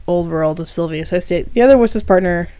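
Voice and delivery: ordinary delivery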